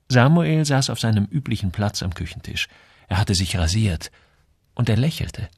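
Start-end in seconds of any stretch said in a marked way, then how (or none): none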